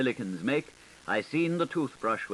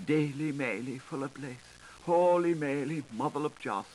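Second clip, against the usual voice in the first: a lightly voiced mock Hail Mary, half chanted; mock prayer; 4 semitones higher than usual. A narrator's reading. Narrator voicing the hiding man's prayer as a light mock chant. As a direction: pray it like a cowering drunkard, only half in earnest.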